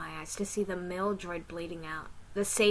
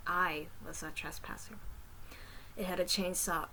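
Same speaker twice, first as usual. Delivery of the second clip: plain reading voice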